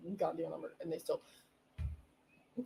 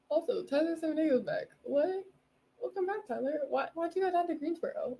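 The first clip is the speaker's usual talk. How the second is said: high, thrilled burst of news; excited; 9 semitones higher, 4 dB louder than usual. Real, not acted. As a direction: squeal it out, delighted he's back. Thrilled Tyler is back.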